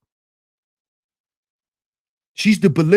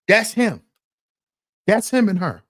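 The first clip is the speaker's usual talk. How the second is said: usual speaking voice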